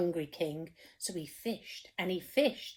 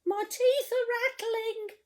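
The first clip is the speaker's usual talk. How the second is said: high, trembling scared cook voice; 11 semitones higher than usual. Acted. Storyteller voicing the cook, scared of the sea, high voice. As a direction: quiver like a frightened wimp. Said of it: "the cook declared".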